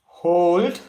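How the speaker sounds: high, loud, drawn-out call; breathing cue. Real, not acted. Instructor cueing students to hold their breath, called out high. Calling breath cues in guided exercise.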